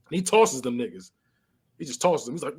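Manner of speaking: normal talking voice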